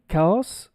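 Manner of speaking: chaos as KAH-oss; mispronunciation. Shown as a demonstration. Teacher warning what English speakers won't understand, chaos as KAH-oss. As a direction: say chaos with a foreign ah vowel. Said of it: "they actually won't even know".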